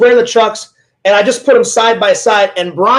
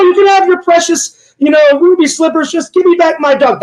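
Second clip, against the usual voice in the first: high, wheedling witch voice; Wicked Witch impression; 7 semitones higher than usual. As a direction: coax her like a greedy witch. Witch bargaining for the slippers.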